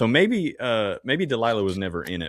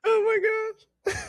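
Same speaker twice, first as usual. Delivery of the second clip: laughing; slower than usual. Real, not acted. Crying with laughter.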